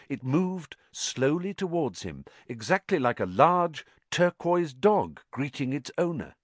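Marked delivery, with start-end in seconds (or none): none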